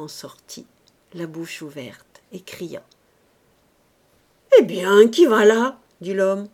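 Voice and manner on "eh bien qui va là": big, startled call; loud challenge. Acted. Man finds a crying child in the bundle, loud challenge. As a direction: challenge it like a sentry.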